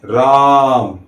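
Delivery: drawn-out call of राम with a long आ; king calling his exiled son, holding the vowel; calling out Ram. Shown as a demonstration. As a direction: call राम from afar, stretching the आ. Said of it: "उनकी याद में उन्हें पुकारते हैं".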